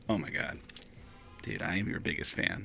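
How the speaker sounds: soft, low starstruck gush; hushed mock fanboy; awed hush. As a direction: breathe it out in awe. Starstruck fan meets the comedian.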